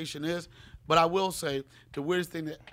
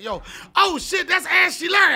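much higher, loud startled shout; shocked yell; 12 dB louder than usual. Retold or imitated. Guest recalling spotting someone at the club, loud high shocked shout. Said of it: "I was like".

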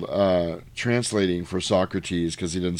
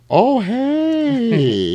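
very high, drawn-out hello; mock greeting; 13 semitones higher, 4 dB louder than usual. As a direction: squeal a long exaggerated "hey". Acting a flirty drawn-out greeting.

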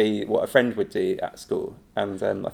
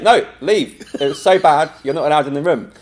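mock stern order; 4 semitones higher, 5 dB louder than usual. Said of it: "He's like".

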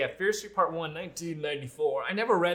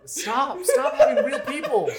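high, squeaky laughing stop; laughing plea; 13 semitones higher, 7 dB louder than usual. Pretend. Friend joining the joke about casting, high laughing plea. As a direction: plead through laughter in a put-on high voice.

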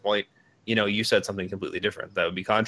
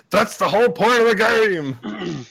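high, slow emphatic rant; loud rant; 10 semitones higher, 6 dB louder, slower than usual. Real, not acted. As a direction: drive the point home loudly. Ranting about lore.

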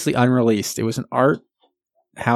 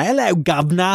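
higher, hammed-up Cockney accent; mock Cockney accent; 8 semitones higher than usual. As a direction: toss off a jokey Cockney greeting in a Cockney accent. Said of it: "That's basically like my, our accent".

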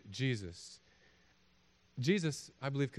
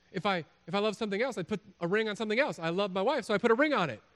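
normal talking voice

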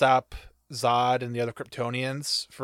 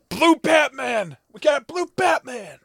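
mock excited fan; 12 semitones higher, 6 dB louder than usual. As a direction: cheer it out like a giddy fanboy. Mocking fans' reaction.

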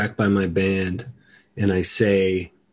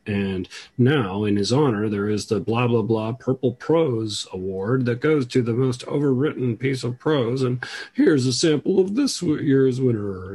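mock CBC host, pitch climbing toward the end; hammed-up radio feature read; radio presenter; 4 semitones higher than usual. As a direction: imitate a host grandly introducing an award. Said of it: "today, the person was like".

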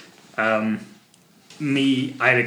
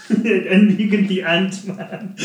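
laughing; 7 semitones higher, 4 dB louder than usual. Real, not acted. Teasing while laughing.

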